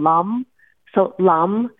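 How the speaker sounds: "lum" said with a short u, like "lum"; pronounce lum. Shown as a demonstration. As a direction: say "lum" to rhyme with "hum", not "lam". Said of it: "we kind of pronounce it more like L U M".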